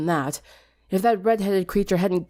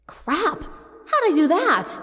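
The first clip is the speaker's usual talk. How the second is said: high, startled yelp into a slow puzzled question; 10 semitones higher, much slower than usual. Acted. Sunset waking to a broken alarm, startled and high. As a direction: jolt awake in alarm, then wonder.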